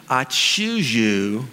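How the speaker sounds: very slow, lingering "I choose you"; tender vow. Retold or imitated. Wife telling him she chose him, tender and slow. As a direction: savour the wife's words tenderly. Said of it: "when my wife told me".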